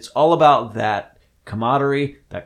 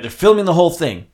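ordinary delivery

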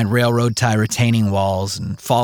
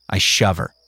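ordinary delivery, nothing marked

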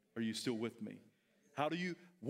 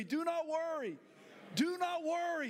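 forceful declaration; 11 semitones higher than usual. Shown as a demonstration. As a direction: proclaim it boldly. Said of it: "say that with me".

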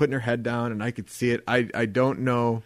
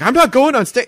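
loud, high protest; mock panicked objection; pleading refusal; 12 semitones higher, 10 dB louder than usual. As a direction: dig in and refuse. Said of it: "pleading with Jill".

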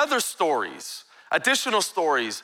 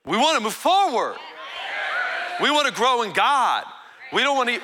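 rising preaching call; 8 semitones higher than usual. Acted. Preacher calling the church to move forward, rousing. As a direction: stir the church up, climbing.

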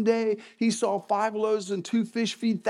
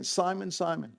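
"Simon, Simon" said softer with a falling end; a quieter echo of the name; gentle "Simon, Simon"; 5 dB quieter than usual. Shown as a demonstration. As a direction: call his old name twice, softly. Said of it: "noticed anything about that".

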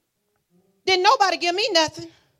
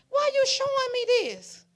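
pleading question to God; 11 semitones higher than usual. Retold or imitated. Preacher repeats her question to God, pleading.

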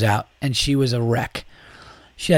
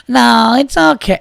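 shrill high, louder mock reassurance; loud drunk woman; 11 semitones higher, 6 dB louder, slower than usual. Drunk woman calling out to the comic, high and loud. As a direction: shout it out much higher and louder. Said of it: "mentioned that the room was late".

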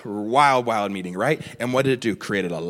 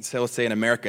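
ordinary talk, nothing marked

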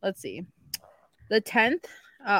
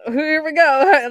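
sarcastic resignation; 6 semitones higher, 9 dB louder, much slower than usual. Retold or imitated.

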